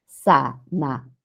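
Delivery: slow, low "Zanahoria" as za, na, o, ria; "Zanahoria" in separate beats, a and o apart; syllable by syllable. Shown as a demonstration. Teacher separates the word for students, syllabified. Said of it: "¿Por qué hemos separado acá?".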